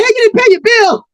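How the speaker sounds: high, loud shout after a customer; hostess calling out. Acted. Hostess calling Cold Blood back to pay, high shout. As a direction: yell it up high as the waitress.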